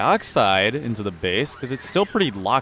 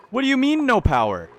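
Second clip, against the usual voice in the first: incredulous; 12 semitones higher than usual. Real, not acted. Streamer arguing with the game about power, high and incredulous. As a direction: shoot the pitch up on mean in disbelief.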